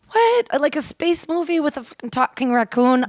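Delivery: mock disbelief, starting on a high-pitched "what"; incredulous scoff. Acting out her skeptical reaction to son.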